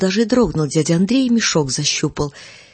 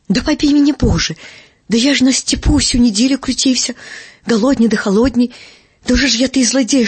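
higher, louder wounded denial; indignant protest; 6 semitones higher, 5 dB louder than usual. Acted. Uncle Andrey denies stealing the goat, loudly indignant. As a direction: swear your innocence, offended.

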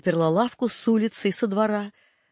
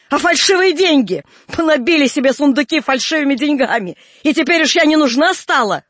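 loud, higher, raging accusations; furious screaming; 4 semitones higher, 7 dB louder than usual. A narrator's reading. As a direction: scream every charge at the family. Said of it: "продолжала Аксинья кричать".

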